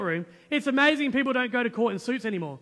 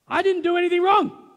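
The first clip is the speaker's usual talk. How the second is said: raised, louder protest of innocence; indignant retort; 6 semitones higher, 7 dB louder than usual. Retold or imitated. To the prosecutor, an indignant, raised protest. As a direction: insist you did nothing wrong.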